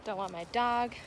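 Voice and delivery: usual speaking voice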